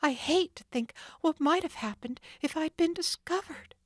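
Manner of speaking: imagine the danger; Nancy shuddering. Acted. Nancy fleeing, shuddering at the thought.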